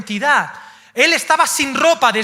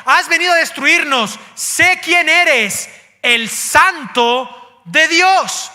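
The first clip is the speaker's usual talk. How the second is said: loud, raised cry of a possessed man, slowing at the end; demon's shout; 5 semitones higher, 5 dB louder, slower than usual. Acted. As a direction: shout it like a demon who recognizes Jesus. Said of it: "y gritó". (grito fuerte de endemoniado, más lento al final)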